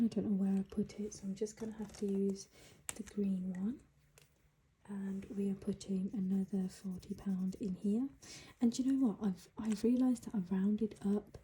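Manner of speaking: ordinary talk, nothing marked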